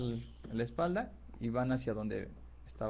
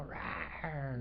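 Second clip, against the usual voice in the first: rugido contenido, a bajo volumen; rugido de león imitado; 9 dB quieter than usual. Acted. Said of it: "les ruge". (rugido fingido de león)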